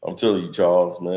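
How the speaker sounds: a hint of Shannon bragging how tough he is; Shannon Sharpe impression. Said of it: "Shannon Sharpe even talking about".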